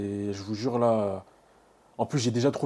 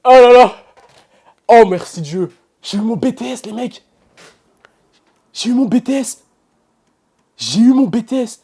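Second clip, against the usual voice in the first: higher and louder, thrilled celebrating; overjoyed; 11 semitones higher, 16 dB louder than usual. Real, not acted. Student tells viewers he passed, overjoyed. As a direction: bask in the win out loud.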